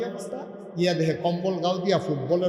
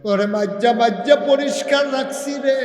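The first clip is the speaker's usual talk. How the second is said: raised, reproachful scold; 7 semitones higher, 6 dB louder than usual. Acted. Sinner scolding his foot, raised and reproachful. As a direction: chew out the traitorous foot.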